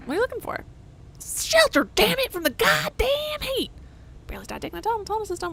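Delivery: put-on very high, yelling old miner. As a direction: squawk it like a cranky old miner. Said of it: "I've changed his accent four times".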